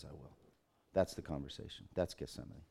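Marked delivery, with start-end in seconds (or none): 0.0-0.3 barely above a breath, very soft; hushed acceptance. Retold or imitated. Son accepting the Father's cup, hushed and very soft. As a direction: let the two words fall almost silent.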